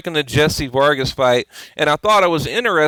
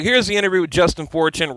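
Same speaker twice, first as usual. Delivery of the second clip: ordinary talk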